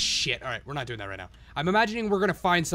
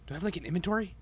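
normal talking voice

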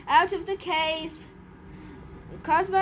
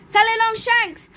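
loud, higher confirmation; emphatic, raised teacher's voice; booming; 5 semitones higher, 8 dB louder than usual. Acted. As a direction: declare the magic word firmly. Examiner firmly repeats magic word.

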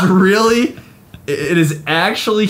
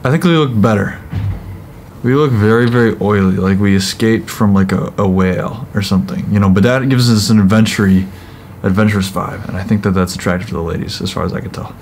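talking through face mask; wearing a sheet mask; 8 semitones lower, faster than usual. Real, not acted. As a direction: lips framed by wet mask. Seen 0: Both people wear wet sheet masks over face; mouth hole stays open.

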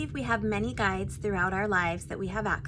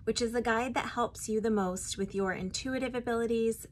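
ordinary talk, nothing marked

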